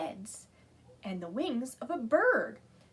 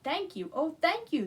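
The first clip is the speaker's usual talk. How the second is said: higher thankful cry; grateful cry; 6 semitones higher, 4 dB louder than usual. A narrator's reading. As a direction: gush your thanks. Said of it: "the grateful witch cried".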